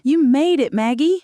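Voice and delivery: overjoyed to see she made it, pitched high. A narrator's reading. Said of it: "He cried when he saw me".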